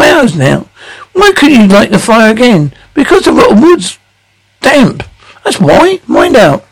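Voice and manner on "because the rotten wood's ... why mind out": near tears. Acted. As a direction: voice cracking high as he breaks down over damp wood. Father near tears over fire.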